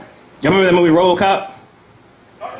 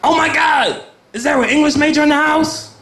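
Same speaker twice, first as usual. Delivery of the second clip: much higher, eager, flustered pleading voice; a confused theatregoer calling out for help, higher and laughing; desperate Shakespeare fan; 3 semitones higher than usual. Retold or imitated. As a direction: beg for an English major like it's an emergency. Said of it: "one day I just wanna hear someone say".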